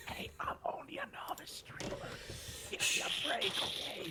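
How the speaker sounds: mock-protesting stage whisper. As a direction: whisper in self-defence, pushing it harder midway. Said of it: "what we're whispering about".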